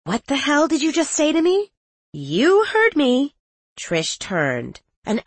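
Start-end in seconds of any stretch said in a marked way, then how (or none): 0.0-1.7 high, outraged demand; indignant and furious; angry. Acted. Penny outraged at insult. 2.1-3.4 high, slow smug retort; deliberate, taunting comeback. Acted. Trish retorts coldly.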